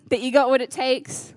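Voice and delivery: normal talking voice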